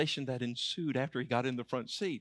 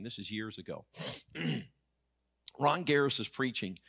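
ordinary delivery

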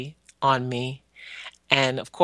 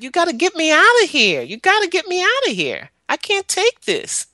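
high, louder desperate plea to God; crying out to God; 5 dB louder than usual. Retold or imitated. Child alone in bed begging God for rescue, high and pleading. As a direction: beg heaven to be rescued, voice straining high. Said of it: "I would just cry out to God".

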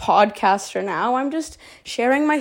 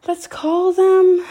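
higher and slow, teasing the reveal; high teasing build-up; 7 semitones higher, 4 dB louder, slower than usual. Pretend. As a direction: build suspense, pitched up. Stalling before naming someone.

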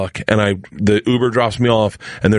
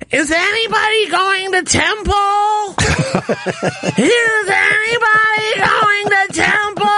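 high, drawn-out whiny wail; hammed-up whine, stretched out and very high; real whiny; slower than usual. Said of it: "Like, real whiny".

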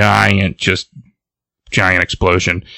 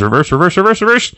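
repeated words climbing higher and higher; acted panic; 9 semitones higher, faster than usual. Driver teetering at the crater's edge, rising mock panic. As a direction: build mock panic as the pitch rises. Truck almost diving into crater.

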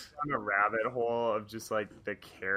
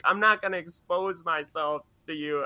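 higher put-on lady's voice; mock-indignant woman, pitched up; indignant refusal; 6 semitones higher than usual. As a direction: refuse as the affronted passenger. Woman refusing in retold argument.

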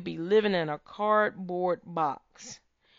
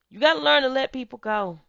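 booming motivational speech; 6 semitones higher, 5 dB louder, faster than usual. Real, not acted. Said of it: "motivational speech".

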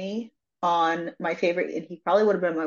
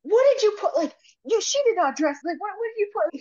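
high-pitched mock outrage; retold indignant demand; 13 semitones higher, faster than usual. Host imitating the candidate quizzing her outfit, mock indignant. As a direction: squeak it out, indignant on his behalf.

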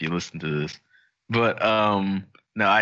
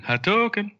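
Hadouken yelled very high like the game character; Ryu's Hadouken; 12 semitones higher than usual. Pretend. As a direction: shout it as Ryu throws a fireball. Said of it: "a coin saying".

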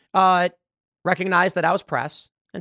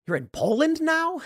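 high, slow disbelieving question, much higher; incredulous; 12 semitones higher, slower than usual. Real, not acted. Reading tweets from people who fled, slow high incredulous question. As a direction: stretch it out in amused disbelief.

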